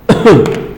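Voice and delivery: sudden choking cough. Real, not acted. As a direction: cough after swallowing wrong way. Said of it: "Engasguei aqui".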